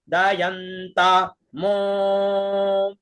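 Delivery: मो held very long in pluta; pluta prolongation. Shown as a demonstration. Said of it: "मो में ऊपर". (मो को प्लुत में खींचकर)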